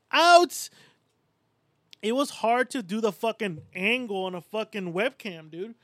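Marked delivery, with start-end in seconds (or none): 0.1-0.7 excited cry. Retold or imitated. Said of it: "I'd be like".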